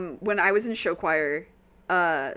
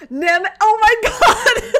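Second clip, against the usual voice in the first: laughing shout, much higher and louder, slow; delighted surprise; 11 semitones higher, 11 dB louder, slower than usual. Real, not acted. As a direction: burst out laughing in shock. Nem shows up at perfect time.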